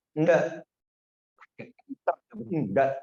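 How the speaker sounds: ordinary talk, nothing marked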